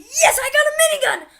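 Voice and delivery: fast, high, loud cry of joy; excited shout. Real, not acted. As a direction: yell it like you hit the jackpot. Gets a new weapon.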